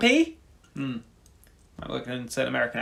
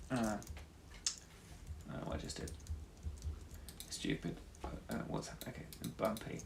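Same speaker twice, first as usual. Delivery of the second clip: muttered to self; 3 semitones lower, 17 dB quieter than usual. Real, not acted.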